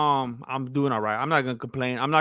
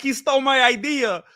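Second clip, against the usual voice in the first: mock accusing voice; 9 semitones higher than usual. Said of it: "be like".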